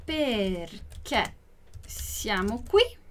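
drawn-out words with a pause between; slow, careful question; slow model reading. Shown as a demonstration. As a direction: stretch each word for learners, rising on "qui". Teacher repeats it slowly for learners.

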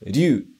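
"ryu" as one sound, ryu-u; teacher models "ryu" in one glide; contracted ryuu. Shown as a demonstration. Teacher showing dragon word: "ryu" as one contracted sound. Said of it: "E a segunda palavra é".

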